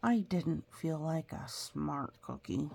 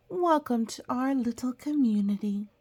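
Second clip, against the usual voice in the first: higher, louder welcome; welcoming cookie; 7 semitones higher, 6 dB louder than usual. Acted. The cookie welcoming the child to the bakery. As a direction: greet them like a proud host.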